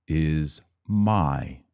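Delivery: ordinary talk, nothing marked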